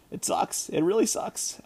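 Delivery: soft, nearly whispered it sucks; whispered frustration. Real, not acted. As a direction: let the frustration drain out under your breath. Frustrated over broken foot.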